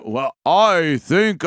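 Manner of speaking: slow, drawn-out mock robot; lightly done clunky robot reply. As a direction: stretch each word like an old machine. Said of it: "exactly what we imagined a robot should be".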